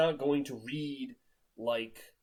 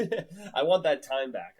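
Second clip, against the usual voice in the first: louder, laughing through it; laughing exasperation; 4 semitones higher, 7 dB louder than usual. Retold or imitated. Reader complaining about wasted reading time, laughing. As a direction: laugh at your own wasted hours. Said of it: "you're like".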